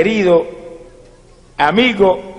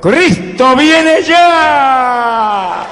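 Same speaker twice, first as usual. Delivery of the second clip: high, long-drawn shouted proclamation; full-force preacher's cry; prophetic shout; 9 semitones higher, 3 dB louder, much slower than usual. Retold or imitated. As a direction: shout it out to the whole crowd, stretching the last word. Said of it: "que le grita a mi pueblo".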